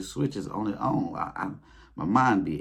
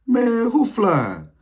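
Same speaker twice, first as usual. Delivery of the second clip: high, loud, slow drawn-out call; a bystander gawking at a flying man; imitating a stranger's jealous holler; 10 dB louder, much slower than usual. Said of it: "I hear somebody say".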